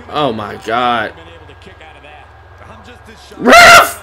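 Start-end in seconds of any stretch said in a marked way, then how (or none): 3.4-4.0 very high, loud shriek; one piercing outraged shout; indignant scream. Real, not acted. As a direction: yell for the referee. Shouting at referee in game.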